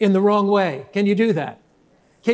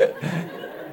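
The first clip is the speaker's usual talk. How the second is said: soft breathy laugh; 10 dB quieter than usual. Real, not acted. Laugh after the story.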